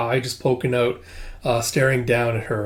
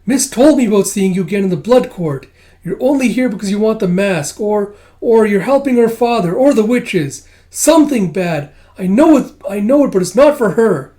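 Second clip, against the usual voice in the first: high, loud, indignant outburst; heated accusation; 8 semitones higher, 8 dB louder than usual. Acted. Kippa accuses the visitor of plotting, heated accusation. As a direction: throw the accusation loudly, voice pitched up.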